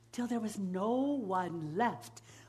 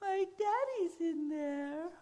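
rising, high sobbing voice; crying; 10 semitones higher than usual. Acted. Girl crying over her daddy's bones. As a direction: break into tears. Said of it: "began to cry".

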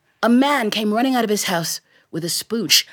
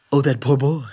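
sorrowful father; 8 semitones lower than usual. Acted. Grocer pities the imagined boy.

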